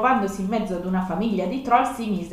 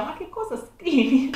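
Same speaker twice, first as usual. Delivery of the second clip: disbelief; 7 semitones higher than usual. Real, not acted. Reacts to her own childhood story.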